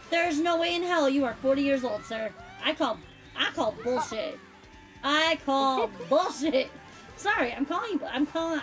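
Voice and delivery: ordinary talk, nothing marked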